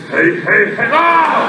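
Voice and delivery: booming cartoon catchphrase voice; Fat Albert. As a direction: do the big cartoon character's holler. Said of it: "he does the Fat Albert voice".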